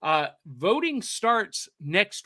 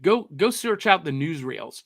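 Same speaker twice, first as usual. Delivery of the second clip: ordinary delivery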